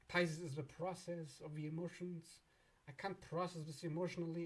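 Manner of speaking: normal talking voice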